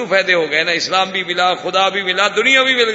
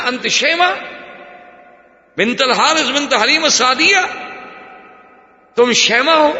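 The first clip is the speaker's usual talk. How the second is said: joyful recognition; 7 semitones higher, 3 dB louder than usual. Acted. Prophet recognises his foster sister.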